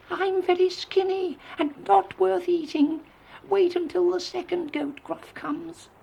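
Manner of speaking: very high, tiny squeal of a voice; squeaky little goat. A narrator's reading. Youngest goat pleads not to be eaten, squeaking. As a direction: squeak like the smallest billy goat. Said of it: "squeaked the youngest billy goat Gruff".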